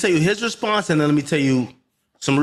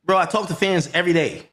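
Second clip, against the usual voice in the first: ordinary talk, nothing marked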